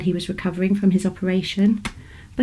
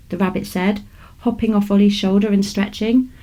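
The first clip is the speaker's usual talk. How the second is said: plain reading voice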